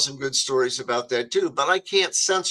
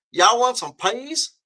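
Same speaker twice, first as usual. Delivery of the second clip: imitating a Southern cafeteria lady's drawl, higher and slow; Southern serving lady; 8 semitones higher, 3 dB louder, slower than usual. Southern cafeteria lady offering corn down the line, slow Southern drawl. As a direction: stretch the Southern accent out slow and high. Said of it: "you imitate 'em, a Southern person".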